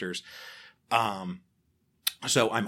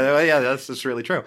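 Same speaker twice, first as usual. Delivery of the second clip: higher and louder, a laugh in the voice; laughing sheepish agreement; 7 semitones higher, 12 dB louder than usual. Retold or imitated. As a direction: concede it with an embarrassed laugh. Sheepish reply to mocking listener.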